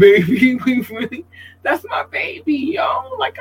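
rising to a high, giddy squeal; squealing over her favorite cousin; gushing squeal. Real, not acted.

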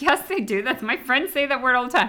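high-pitched insistent comeback; imitated protesting teen. Mother imitating her kid's comeback, imitated protest. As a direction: mimic a kid arguing back, pitched well up. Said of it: "And you're like".

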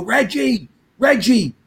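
high yelled name; calling out. Retold or imitated. Yelling after Reggie at bus.

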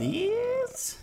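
high squeaky begging voice; squeaky plea. Acted. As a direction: beg in a tiny high voice. Begging for linebackers.

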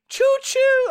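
high, drawn-out choo-choo; mock train whistle. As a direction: hoot it like a train whistle, stretched out. Imitates a hype train's whistle.

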